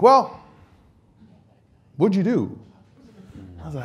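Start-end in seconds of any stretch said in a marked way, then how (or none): 0.0-2.6 a loud, high, startled question; boss's loud surprise. Retold or imitated. Military boss hearing the news, loudly startled. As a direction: demand an answer in shock. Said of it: "he's like".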